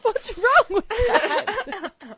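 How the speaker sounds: loud, slow, laughing challenge; mock indignant. Real, not acted. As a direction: demand an answer, half laughing. Defending his breakfast habit.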